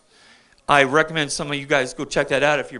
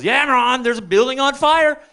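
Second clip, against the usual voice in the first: Rudy alarmed; 8 semitones higher, 3 dB louder than usual. Retold or imitated. Rudy reports fire.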